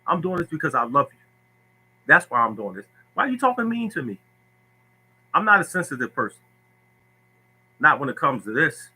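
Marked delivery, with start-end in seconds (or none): 3.1-4.2 high mock whine; whiny player. Sensitive player whining to coach, mock whine. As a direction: sulk like a hurt kid.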